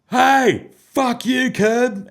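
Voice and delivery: high, loud heckle from afar; imitated distant shout. Stranger hailing comedian in an American laneway, imitated shout. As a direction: call it out like a fan spotting someone.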